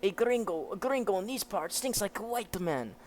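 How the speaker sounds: a rough, sneering outlaw; lightly voiced menacing thug; gruff villain. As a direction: sneer it like a desert bandit. Reading manga, villain voices.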